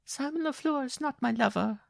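very high, tearful protest; sobbing. A narrator's reading. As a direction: deny it in a high sobbing wail. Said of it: "she sobbed".

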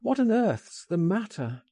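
high-pitched, baffled question; alarmed cry. A narrator's reading. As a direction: demand to know what is wrong. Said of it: "I cried".

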